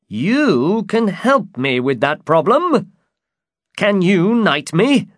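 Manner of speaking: high, slow, hopeful knight; eager high-pitched storybook knight; Don Quixote. Acted. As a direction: plead grandly for a favour. Don Quixote asks a favour.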